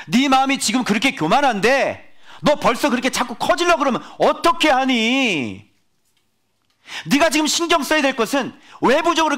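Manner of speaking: a divine voice dressing him down; God's stern rebuke. Acted. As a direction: scold like a stern father. God rebuking the preacher.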